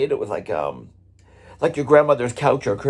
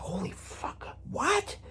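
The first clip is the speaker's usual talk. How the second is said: whispered disbelief jumping high on the last word; shocked whisper; 13 semitones higher, 12 dB quieter, much slower than usual. Retold or imitated. Drummer hearing he hit a fan with a stick, whispering in shock. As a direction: gasp it under your breath, then squeak the what. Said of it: "I was like".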